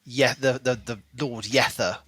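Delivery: ordinary talk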